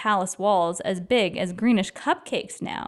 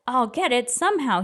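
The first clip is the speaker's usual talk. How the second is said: plain reading voice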